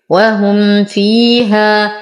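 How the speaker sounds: slow "وهم فيها" with a clear m before the fa; إظهار شفوي. Shown as a demonstration. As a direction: recite "وهم فيها" drawn out, m and f distinct. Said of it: "فالحكم إظهار شفوي". ("وهم فيها" ببطء مع إظهار الميم)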